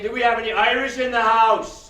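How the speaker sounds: loud call-out in an Irish persona; calling to the crowd. Acted. Host in Irish persona calling out to the audience, loud. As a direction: rouse the house with a big question.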